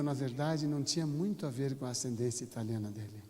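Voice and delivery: ordinary delivery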